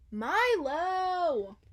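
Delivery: high, loud call across the field. Acted. Girl calling her dog across the field, loud. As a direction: call the dog from far away. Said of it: "she called".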